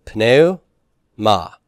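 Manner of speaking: very slow "pneuma" with an aspirated P up front; "pneuma" spoken syllable by syllable, P kept; P sounded. Shown as a demonstration. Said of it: "we're gonna pronounce that P".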